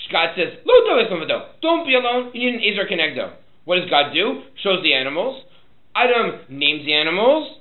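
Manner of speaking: loud, high recital of verse and story; forceful preaching. Real, not acted. Preaches the text at full force.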